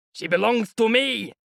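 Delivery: high, loud possessive bellow; defiant shout. A narrator's reading. Mikolka answers the crowd, defiant angry shout. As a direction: roar it back at the crowd. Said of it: "Mikolka shouted".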